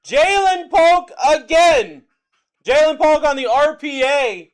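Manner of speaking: loud, stretched-out call of a rookie patch auto; hyped shout. Real, not acted. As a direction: announce the big hit to the stream. Hyped call of a big hit.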